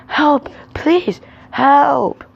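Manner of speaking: high, loud acted pleas for help; terrified cry. A narrator's reading. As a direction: beg for help in high-pitched alarm. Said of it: "Sam was terrified".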